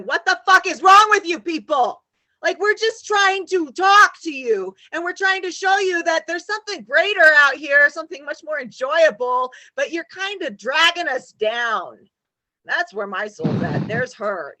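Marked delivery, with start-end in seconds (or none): 0.0-12.0 much higher and louder, slowed; furious lecture to the group; yelled at the people online; ranting yell. Retold or imitated. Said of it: "getting online going".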